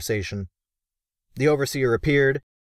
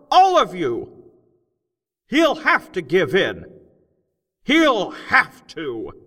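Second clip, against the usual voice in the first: loud, high, slow speechmaker shouting to a cavern crowd; loud, high, slow rally speech; rousing oration; 13 semitones higher, 6 dB louder, slower than usual. A narrator's reading. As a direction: whip up the crowd of gnomes, big and deliberate. Crockett's strike speech.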